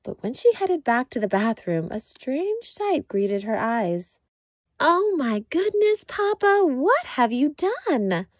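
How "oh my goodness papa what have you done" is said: higher, louder dismayed exclamation; shocked cry. A narrator's reading. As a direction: catch sight of the bubble mess. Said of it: "cried Mama".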